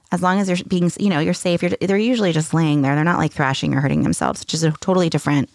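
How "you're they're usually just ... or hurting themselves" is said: usual speaking voice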